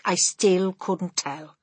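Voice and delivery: ordinary delivery, nothing marked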